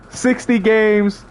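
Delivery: ordinary talk, nothing marked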